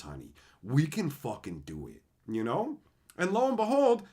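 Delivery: usual speaking voice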